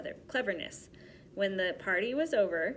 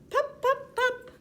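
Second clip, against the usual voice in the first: popping sounds; 8 dB louder, slower than usual. A narrator's reading. Buttons bursting.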